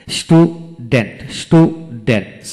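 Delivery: Student slowly split into two beats; syllable by syllable. Shown as a demonstration. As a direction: leave a gap inside student. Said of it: "যতটুকু অংশ একসাথে উচ্চারণ করা যায়".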